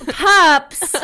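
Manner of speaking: high, loud startled cry; shocked shout. Real, not acted. Reader shocked by the breeding line, loud and high. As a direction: yelp the word in disbelief.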